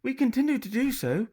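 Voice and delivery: put-on girl faking an English upper-class accent to a pirate. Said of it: "in her best English upper-class accent".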